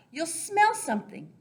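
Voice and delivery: ordinary talk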